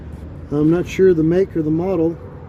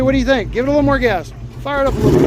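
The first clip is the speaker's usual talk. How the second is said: raised, higher, eager encouragement; excited calling out; 7 semitones higher, much faster than usual. Real, not acted. Coaching son over running engine.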